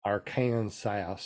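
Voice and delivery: Arkansas stretched out slowly, "ar-kan-sigh"; drawn-out pronunciation. Shown as a demonstration. Answering a pronunciation challenge, Arkansas slowly drawn out. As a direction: draw out Arkansas in slow syllables.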